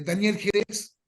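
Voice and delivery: ordinary talk, nothing marked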